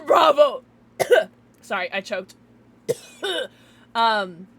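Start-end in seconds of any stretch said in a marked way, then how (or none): 0.1-0.6 loud whooping cheer; cheering shout. Real, not acted. Streamer cheering Bravo Penguin, loud cheer. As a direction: shout it out like a toast.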